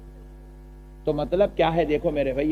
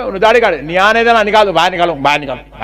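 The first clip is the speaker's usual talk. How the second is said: mock angry clerics; 8 dB louder than usual. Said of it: "اتنے danger ہو گئے".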